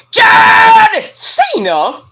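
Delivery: long, high, loud drawn-out name call; announcer call. Pretend. Podcaster calls out the wrestler's name like a ring announcer, long and loud.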